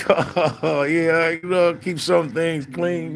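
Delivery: high, slow drawl mocking a nagging wife; lightly mocking his wife's chores request.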